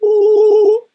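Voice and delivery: very high, loud squealing ooh. Shown as a demonstration. Another quick character demo, loud high squeal. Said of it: "I can be like".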